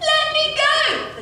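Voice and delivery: high, drawn-out shout; loud, struggling yell. A narrator's reading. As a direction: shout it high, stretching the words. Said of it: "As Cindy shouted".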